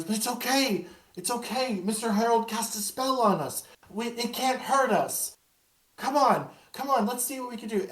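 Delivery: put-on high child voice, brave; a girl calming her scared friend; reassuring ghost child. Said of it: "And she goes".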